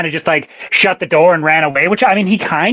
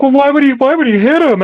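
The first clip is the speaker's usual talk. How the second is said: high mock-questioning voice; put-on whiny-critic questioning, quick and high; mimicked objectors; 9 semitones higher, 3 dB louder than usual. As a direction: imitate people protesting, pitched up. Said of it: "people are like".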